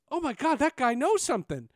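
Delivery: high, loud alarmed exclaim; shocked realization. Retold or imitated. Intern realizing the caller knew, high and alarmed. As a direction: realize it all at once. Realizing the anchor was wrong.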